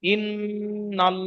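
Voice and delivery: ইন্নাল drawn out very slowly, with a full, round-mouthed heavy ল; heavy l demo. Shown as a demonstration. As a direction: stretch ইন্নাল with a thick, rounded ল. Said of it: "তাহলে কি হবে?". (ইন্নাল ধীরে টেনে, মোটা গোল ল উচ্চারণে)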